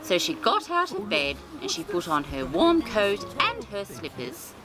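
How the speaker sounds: plain reading voice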